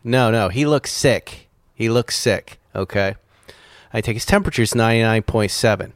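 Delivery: ordinary talk, nothing marked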